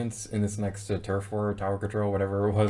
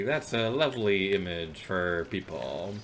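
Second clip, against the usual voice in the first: usual speaking voice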